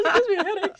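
high, laughing mimicry; laughing imitation. Coach retelling a funny teammate's complaint, high and laughing.

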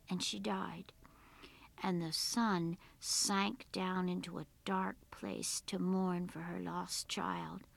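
plain reading voice

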